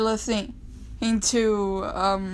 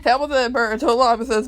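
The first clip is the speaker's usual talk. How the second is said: eager rush; 3 semitones higher, 7 dB louder, faster than usual. Real, not acted. Fast emphatic explanation.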